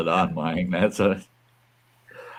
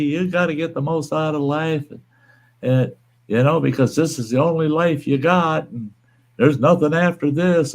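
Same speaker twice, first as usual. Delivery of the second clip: higher-pitched, insistent mock lecture; imitating preachy atheist; 8 semitones higher than usual. Atheist telling him this life is all, mock-insistent. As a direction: mimic him pressing the point, pitched up.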